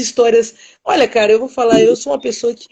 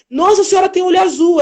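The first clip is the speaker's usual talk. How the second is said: amazed; 8 semitones higher than usual. Retold or imitated. Marveling at the old lady.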